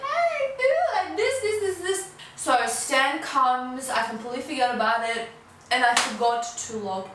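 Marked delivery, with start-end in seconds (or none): 0.0-2.1 much higher, teasing "Hey, boo"; mock-flirty coo at a friend. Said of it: "I was like".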